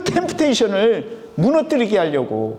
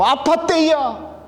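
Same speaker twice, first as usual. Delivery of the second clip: high, drawn-out Chungcheong dialect; 8 semitones higher, slower than usual. Shown as a demonstration. Preacher repeating the saying for wakefulness, Chungcheong dialect. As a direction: call it out slowly in Chungcheong dialect. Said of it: "우리 충청도 사투리로 뭐라고 한다고요".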